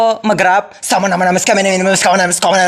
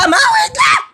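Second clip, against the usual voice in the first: high shout to mom; calling out; slower than usual. Acted. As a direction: call out to mom downstairs. Calling mother from rooftop.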